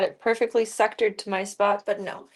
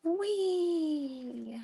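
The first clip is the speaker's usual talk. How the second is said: excited whee; 7 semitones higher, 4 dB quieter than usual. Real, not acted. Fun exclamation.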